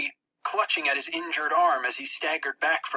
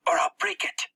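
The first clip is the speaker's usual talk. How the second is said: higher, tense threat. Acted. Narrator threatening Max while twisting his arm. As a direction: warn him you'll snap it.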